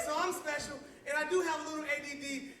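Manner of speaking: usual speaking voice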